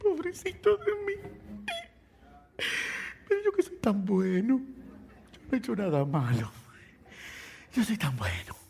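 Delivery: hammed-up poor-me whine; mock crying. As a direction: play up the self-pity, as if sobbing. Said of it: "sentarme a llorar".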